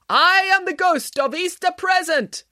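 high, slow ghostly announcement; Ghost of Easter Present. A narrator's reading. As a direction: proclaim it like a theatrical spirit. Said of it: "announced Michael".

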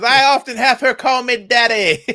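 a hammed-up, suggestive joke voice; mock sultry daddy. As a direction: ham up the innuendo. Joking about being called daddy.

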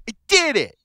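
put-on high, loud little-kid cry of triumph; excited toddler exclaiming. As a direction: shout it like a proud toddler.

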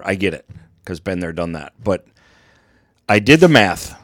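usual speaking voice

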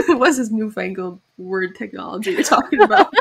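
put-on laughing, baffled old-fashioned voice; mock confused public. Imitating the confused public, laughing. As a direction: scoff at the new word through a laugh. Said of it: "The public would be like".

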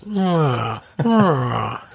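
high human-like wail, falling away; voice-like crow cry. Retold or imitated. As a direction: imitate a crow that sounds like a crying baby. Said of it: "It was like this".

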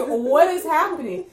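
loud, high-pitched comic disbelief; mock bewilderment. Grandchild seeing grandma asleep in the driveway, loudly baffled. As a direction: exclaim it as if utterly baffled.

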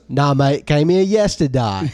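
put-on joke-telling Australian accent; easygoing mock Australian, drawing out the last word. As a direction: answer in a put-on Aussie accent. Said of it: "this Australian guy moves to the United States".

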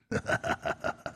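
soft, breathy villain chuckle; quiet mock-evil laugh; sinister chuckle. Host playing evil billionaire, sinister soft chuckle.

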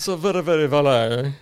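a posh British valet, hammed up; mock British accent. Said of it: "little British accent".

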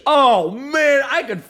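loud, high, drawn-out showman delivery; mock hyped-up. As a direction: boom it out slowly like a big announcement. Hypes up voice with headphones on.

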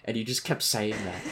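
ordinary delivery

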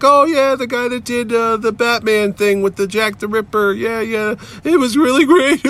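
high mock-tearful gushing; almost in tears. Depressed fan praises Mignola's Batman work, mock tearful. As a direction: gush in a high voice, pretending to near tears. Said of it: "And I'm, like, almost in tears".

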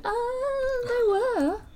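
sudden realization. Retold or imitated. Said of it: "I just had a moment going".

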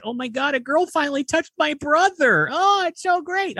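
mock girlish gush; little sister voice. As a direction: squeal it like an excited kid sister. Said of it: "The little sister's like".

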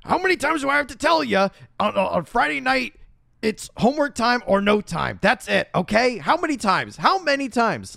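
high, loud scolding dad; nagging partner. Retold or imitated. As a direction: scold her like a fed-up father. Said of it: "That was good".

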